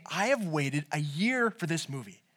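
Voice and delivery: low, soft, measured restatement; slow, deliberate quote. Retold or imitated. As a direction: say it slowly and softly, weighing each word. Slow restating of the ranted line.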